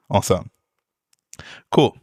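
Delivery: ordinary talk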